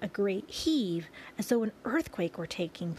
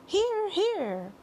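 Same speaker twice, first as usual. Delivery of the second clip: high, swooping shout of approval; group cheer; 12 semitones higher, 4 dB louder than usual. A narrator's reading. All the creatures backing the ladybug's speech, high cheering shout. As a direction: cheer heartily, voice pitched high. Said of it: "they all shouted".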